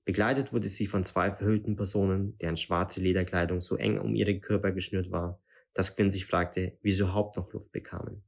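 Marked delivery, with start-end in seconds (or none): none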